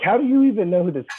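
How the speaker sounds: high, fast, baffled dad retelling; incredulous. Retold or imitated. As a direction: blurt it out in retold disbelief. Dad's retold reaction to toddler's request.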